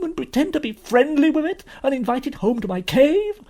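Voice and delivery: loud, high, outraged faun; anguished self-reproach. Acted. Faun accusing himself before Lucy, anguished.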